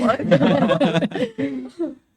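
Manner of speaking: riso alto falando; rindo. Real, not acted. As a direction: fale rindo, mais alto. Riso ao encerrar. (rindo ao notar que falam por horas)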